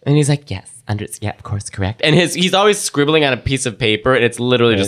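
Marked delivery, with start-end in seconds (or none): 0.5-2.0 low, soft assured murmur; hushed mock psychic. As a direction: mumble agreement like a fake medium. Psychic mimicked.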